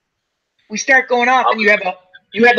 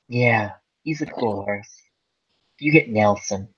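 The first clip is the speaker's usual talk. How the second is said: low, slow and soft, imitating a smug put-down; understated, low mock reassurance; mock jackass stable hand; 8 semitones lower, 6 dB quieter than usual. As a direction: draw it out quietly as an imitated wind-up. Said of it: "the person being a jackass goes".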